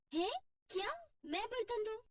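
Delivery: high, indignant disbelief; shocked disbelief. Acted. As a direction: balk at the very idea. देवरानी shocked at being asked. (हैरानी से ऊँची आवाज़ में)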